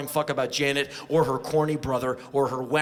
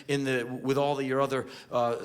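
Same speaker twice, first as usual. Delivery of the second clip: ordinary talk, nothing marked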